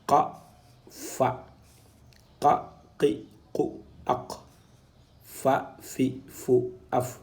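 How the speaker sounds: "Qa, qi, qu, aq" then "Fa, fi, fu, af", each syllable distinct; contrasting qa and fa. Shown as a demonstration. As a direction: drill qaf against fa through each vowel. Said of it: "Ici, nous avons un qa".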